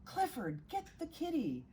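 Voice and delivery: put-on boy telling his big dog to fetch the kitten; Tim calling his dog. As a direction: call up to Clifford, pitched high. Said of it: "Tim said".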